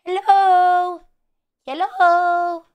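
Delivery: high, sing-song drawn-out greeting; bird voice. Shown as a demonstration. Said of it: "that's my bird voice".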